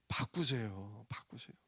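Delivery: low, soft appeal; quiet urging. Real, not acted. Preacher telling congregation to change their prayer, quiet urging. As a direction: drop the voice and urge them gently.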